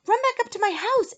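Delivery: high, very loud shout; urgent shout. A narrator's reading. Eric orders friends to flee, urgent high shout. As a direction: shout it like a panicked escape order. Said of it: "Eric shouted".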